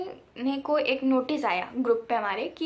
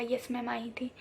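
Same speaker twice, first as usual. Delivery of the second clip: meek whisper; 8 dB quieter than usual. Retold or imitated. Meek answer to annoyed teacher.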